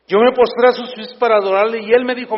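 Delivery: preaching at full force. Acted. Preacher reading scripture fervently.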